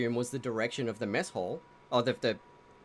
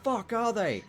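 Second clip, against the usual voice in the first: high, exasperated orc outburst; angry searcher yelling out in disbelief; cursing orcs; 7 semitones higher than usual. Acted. Game master voices orcs hunting the hidden party, angry. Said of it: "They're cursing and going".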